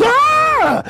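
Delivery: high startled yelp from the thief; thief's startled scream. Retold or imitated. Preacher voicing the thief's scream, high yelp. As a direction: play the thief's fright. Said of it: "And he screams".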